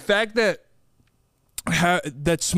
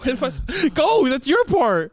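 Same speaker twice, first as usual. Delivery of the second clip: higher, louder protest; loud complaint; 7 semitones higher, 4 dB louder than usual. Real, not acted. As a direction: push back loudly at your partner. Frustrated during practice.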